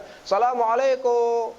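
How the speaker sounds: drawn-out, high Syrian-style salam. Shown as a demonstration. Lecturer showing how a Syrian gives salam, drawn out. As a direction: sing the greeting the Syrian way, slow and lifted. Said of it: "dia bagi salam pun ada cara dia".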